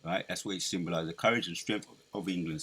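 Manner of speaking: ordinary talk, nothing marked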